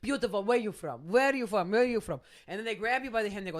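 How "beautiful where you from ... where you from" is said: put-on gushing, foreign-accented flattery; hammed-up accented street-seller patter; salesman's foreign accent. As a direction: pour on compliments in a foreign accent. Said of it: "rolls up with an accent".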